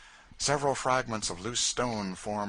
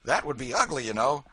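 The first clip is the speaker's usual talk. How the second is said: louder and higher, nervous caution; fretting about getting shot; Marks, wary slave catcher; 6 semitones higher, 6 dB louder than usual. A narrator's reading. Marks warning Tom, nervous.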